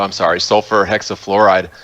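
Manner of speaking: normal talking voice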